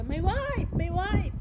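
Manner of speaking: high, excited mock shout of May wife; shouted call. Driver calls to his wife at the door, high excited shout. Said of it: "Sumigaw siya".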